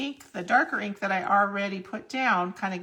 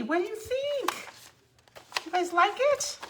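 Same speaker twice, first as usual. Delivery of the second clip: eager question; 12 semitones higher than usual. Real, not acted. Showing off finished card.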